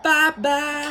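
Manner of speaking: loud, high Southern-accented imitation; Southern grandma accent. Grandma echoing granddaughter's bye-bye, exaggerated Southern accent. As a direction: echo it with a big Southern accent. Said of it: "If that wasn't a grandma bye-bye".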